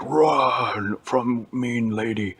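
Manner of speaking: loud, slow monster bellow; Hulk. Acted. Hulk flees the mean lady, bellowing. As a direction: stretch it into a big brute's cry. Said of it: "And Hulk was like".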